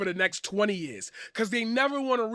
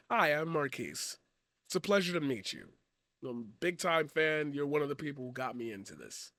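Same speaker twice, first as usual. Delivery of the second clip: quiet, lower, person to person; sincere; 6 semitones lower, 8 dB quieter than usual. Real, not acted. Introducing himself to Natalie as a fan, soft sincere voice. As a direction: speak softly like meeting a hero. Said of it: "I'm ripping off the paradigm mask".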